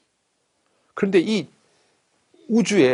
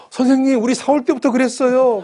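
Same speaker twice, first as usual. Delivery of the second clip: much higher, loud excuse; flustered teacher; 11 semitones higher, 7 dB louder than usual. Retold or imitated. Teacher excusing the tilted globe to the inspector, high flustered voice. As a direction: squeak out a lame excuse.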